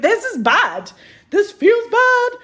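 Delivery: high, loud, slow wail of dread; mock scared whimper. An actor in a cold audition room, a high, slow, scared wail. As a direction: wail it like a frightened kid. You're like.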